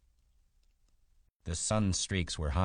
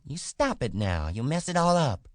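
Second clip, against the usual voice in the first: woman's angry cry, rising high and loud; 10 semitones higher, 5 dB louder than usual. A narrator's reading. Wife angry at the man messing her hair, crying out. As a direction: build to a high, cross outburst. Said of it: "she cried angrily".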